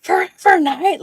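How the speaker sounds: high, loud whispery mock scream; strangled, high-pitched fan shriek, re-enacted; retold scream. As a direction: imitate a starstruck scream with little voice. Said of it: "And I screamed at him".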